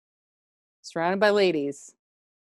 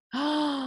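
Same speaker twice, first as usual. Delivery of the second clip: gasp; 5 semitones higher, 6 dB quieter than usual. Real, not acted. Mock shock at herself.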